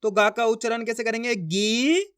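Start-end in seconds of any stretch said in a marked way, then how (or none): 1.3-2.1 गीत split into a long rising गी, then त; drawn-out syllables. Shown as a demonstration. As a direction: sound out गीत slowly, piece by piece. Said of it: "पढ़ना आपको खींच के". (गीत को तोड़कर, खींचते हुए)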